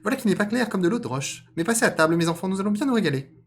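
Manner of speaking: high, loud cartoon voice; father rabbit. Rabbit seating his children for dinner, high loud voice. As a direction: call the family to table at a high pitch.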